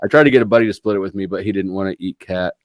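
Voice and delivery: ordinary talk, nothing marked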